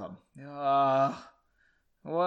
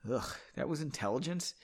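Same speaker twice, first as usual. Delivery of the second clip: plain reading voice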